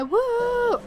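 high, sudden cheer; excited whoop. Real, not acted. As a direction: let out a happy whoop. Cheering at a game moment.

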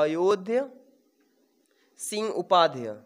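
"Ayodhya Singh Upadhyay" with a long pause after Ayodhya; dictating a name. Shown as a demonstration. Teacher repeats name for dictation. ("Ayodhya Singh Upadhyay" को ठहर-ठहर कर बोलें)

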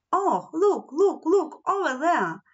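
high, eager repeated calls; mock-shout to the others to see; lightly acted storybook excitement; excited shout. A narrator's reading. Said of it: "Dolly shouted".

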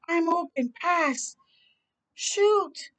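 usual speaking voice

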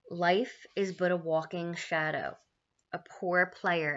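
slow, measured Shakespeare verse; slow mournful recital; soliloquy recitation. Acted. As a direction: weigh every word of the speech.

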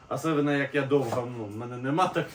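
normal talking voice

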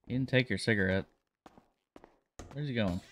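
ordinary talk

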